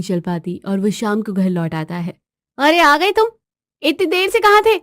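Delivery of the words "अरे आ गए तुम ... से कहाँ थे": higher-pitched questioning wife; wife pouncing on her late husband; demanding wife. Acted. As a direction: confront a latecomer in a raised pitch. Wife confronts husband coming home late.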